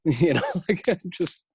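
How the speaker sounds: ordinary talk, nothing marked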